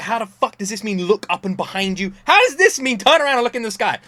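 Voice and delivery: incredulous rant. Retold or imitated. Exasperated retelling of wife misunderstanding.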